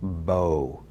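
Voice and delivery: "bo" said with a rounded o; Hebrew bo. Shown as a demonstration. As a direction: say "bo" with an oh sound. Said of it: "many times this word is pronounced".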